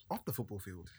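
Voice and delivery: usual speaking voice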